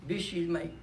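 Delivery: ordinary delivery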